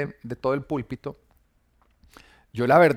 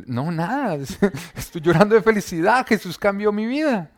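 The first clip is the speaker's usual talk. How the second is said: higher and louder, tearful and laughing through it; crying with joy; 6 semitones higher, 4 dB louder than usual. Retold or imitated. At the door telling his roommate Jesus changed him, crying with joy. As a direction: blurt it out overwhelmed by happy tears. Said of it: "yo estaba llorando".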